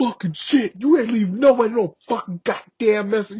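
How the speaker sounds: loud, high imitation of an irate superior; cussing out. Sergeant major rejecting the excuse about messages, imitated furious yelling. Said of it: "cussing me out".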